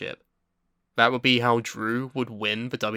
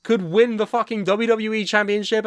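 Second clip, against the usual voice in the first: much higher, loud, exasperated; outraged rant; 9 semitones higher, 4 dB louder than usual. Real, not acted. Fan ranting to Andy about the booking, high and exasperated. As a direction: vent at the bookers who fucked it up.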